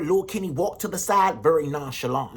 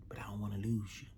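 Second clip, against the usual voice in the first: pleading whisper, much softer; almost no voice, just breath; mother whispering her plea to her son; 7 semitones lower, 12 dB quieter, faster than usual. Acted.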